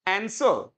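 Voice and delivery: आंसर as AN-suh, falling; correct pronunciation. Shown as a demonstration. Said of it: "it is called answer". (आंसर को सही 'ऐनसअ' कहना)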